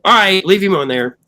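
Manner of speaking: high, loud verdict; the room deciding together, high and loud; decisive call. Retold or imitated. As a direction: announce the decision. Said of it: "they went".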